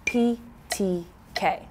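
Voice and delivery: P T K said slowly, each letter apart; teacher drilling the letters slowly; slow demo. Shown as a demonstration. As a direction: spell out P T K slowly. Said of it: "is this".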